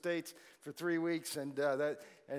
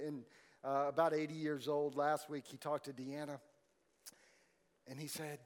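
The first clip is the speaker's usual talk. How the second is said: usual speaking voice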